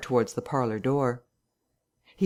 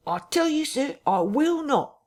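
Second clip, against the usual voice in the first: voice rising; 13 semitones higher than usual. A narrator's reading. Vicar refusing the invisible man, his voice rising. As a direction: climb in pitch as you dig in. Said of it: "his voice rising".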